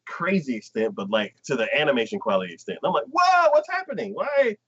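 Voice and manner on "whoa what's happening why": high, amazed rush. Retold or imitated. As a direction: marvel at the sudden budget. Own awed reaction.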